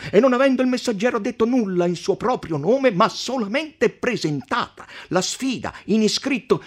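a magistrate lecturing the table on envoys; pompous podestà. Acted. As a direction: lecture the count with proverbs.

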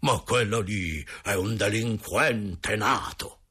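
slow, outraged exclamation in character; utterly indignant. A narrator's reading. Carlo Nelli condemning the boy, at the height of indignation. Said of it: "esclamò al colmo dello sdegno".

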